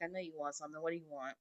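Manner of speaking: see right through him, quietly; dry knowing reply. Retold or imitated. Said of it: "I'm like".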